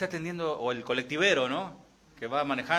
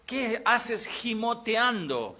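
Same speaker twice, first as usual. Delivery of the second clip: high, slow, drawn-out reproach; God chiding a whimpering Moses, slowly; mock scolding God; 8 semitones higher, much slower than usual. God scolding Moses for whimpering, high and slow. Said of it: "Dios les está diciendo".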